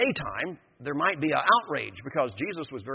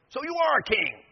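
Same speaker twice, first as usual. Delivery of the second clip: pressing question; 12 semitones higher, 5 dB louder than usual. Acted. Pilate confronts Jesus.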